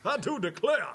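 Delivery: high, loud blustering cartoon rooster drawl, cracking up; loud cartoon rooster drawl through laughter; Foghorn Leghorn impression. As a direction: boom it out like a pompous Southern rooster. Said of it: "You do that pretty well".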